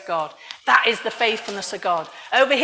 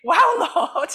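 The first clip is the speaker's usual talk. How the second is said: higher awed exclamation with a laugh in it; amazed, laughing thanks to God; open-mouthed amazement; 6 semitones higher, 4 dB louder than usual. Retold or imitated. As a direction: re-enact stunned wonder, mouth hanging open. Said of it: "with my mouth open thinking".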